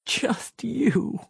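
breathy whisper; dying psychologist whispers it back. A narrator's reading. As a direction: breathe it out, barely voiced. Dying woman repeats, whispering.

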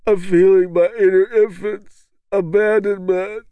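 high, slow, tearful words through a hand over the face; muffled sobbing. A narrator's reading. Kevin answers the leader through tears, muffled and sobbing. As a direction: talk through sobs behind your fingers. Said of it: "Kevin's voice is muffled by the hand he hides behind".

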